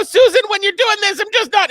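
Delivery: loud, very high-pitched impression of a candidate. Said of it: "higher register".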